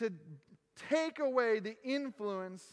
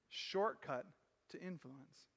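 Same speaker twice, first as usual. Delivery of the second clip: hushed refrain; 7 semitones lower, 8 dB quieter, slower than usual. Acted. Repeated refrain, very soft.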